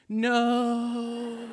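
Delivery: mock wail of the wife being dragged away; wife's cry. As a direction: cry out "No" as the ride drags her off. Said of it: "her going".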